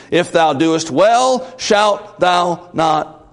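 fiery preaching. Acted. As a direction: preach it hard to the congregation. Preaching at full force.

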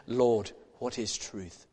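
soft, slow, reverent asking; a hushed, holy-sounding Jesus-movie line; pious movie Pilate. Retold or imitated. Preacher mocks the pious film version, softly and slowly. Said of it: "No, I don't think that was it".

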